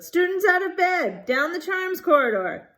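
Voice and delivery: high, loud bellowing alarm. A narrator's reading. Peeves calling Filch, high loud bellow. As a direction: shout it down the corridor. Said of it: "Peeves bellowed".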